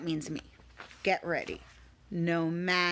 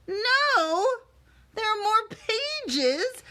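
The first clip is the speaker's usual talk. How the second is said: mock dismay; 12 semitones higher, 3 dB louder, much slower than usual.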